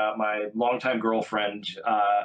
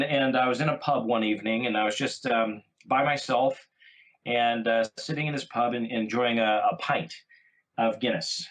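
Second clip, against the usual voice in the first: normal talking voice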